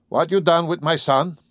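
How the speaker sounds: lightly voiced blunt demand, stress on you; demanding old farmer. A narrator's reading. Father demanding his son from her, blunt.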